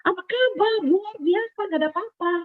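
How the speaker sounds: high bright greeting, all is well; cheery front. Retold or imitated. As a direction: put on a brave, chirpy face. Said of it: "Setiap kali ketemu".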